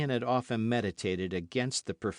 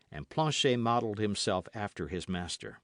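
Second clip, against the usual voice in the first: ordinary delivery, nothing marked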